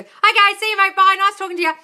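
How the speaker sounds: high, loud Aussie phone sign-off, imitated; fast Australian accent. Brother ending the call with her fiancé, mock Australian accent. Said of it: "talking too fast in an Australian accent".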